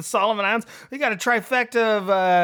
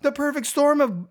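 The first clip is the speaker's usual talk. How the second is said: higher, fired-up rant; worked up; 8 semitones higher, slower than usual. Real, not acted. Host rants to co-host about the football season, worked up. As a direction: vent it, getting carried away. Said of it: "I'm worked up".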